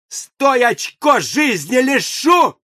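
very high, loud, slow shouted threat; yelling. A narrator's reading. Senka grabs the revolver and threatens Ochko, loud slow yell. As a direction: bellow the threat at him. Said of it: "как заорёт".

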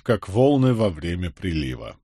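ordinary delivery

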